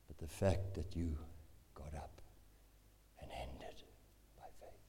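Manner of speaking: low, very soft, halting preaching; solemn low murmur with long pauses; hushed exhortation. Real, not acted. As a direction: let it sink to a whisper.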